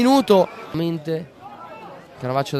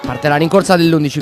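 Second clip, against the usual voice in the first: normal talking voice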